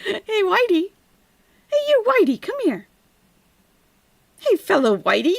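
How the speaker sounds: high-pitched put-on calling; mock greeting. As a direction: beckon him in a high mocking voice. Mimicking the streamer calling a man.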